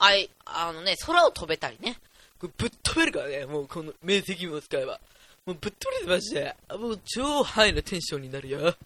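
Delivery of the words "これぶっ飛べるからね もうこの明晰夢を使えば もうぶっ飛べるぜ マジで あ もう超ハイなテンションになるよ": hyped dream voice. Retold or imitated. Telling viewers about lucid dreaming, in a hyped-up voice. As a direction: sell the thrill of flying in a dream. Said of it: "みたいなやつですよ".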